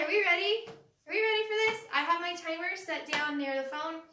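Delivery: usual speaking voice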